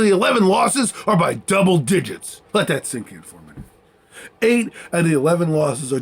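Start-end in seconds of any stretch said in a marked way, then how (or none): 2.5-3.6 low aside. Real, not acted. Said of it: "dramatic low aside".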